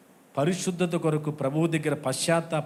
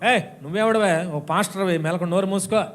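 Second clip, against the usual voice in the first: loud, higher mocking retort; scornful sailor; 3 semitones higher, 4 dB louder than usual. Sailor scolding Paul to shut up, loud and scornful. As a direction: shout him down with contempt.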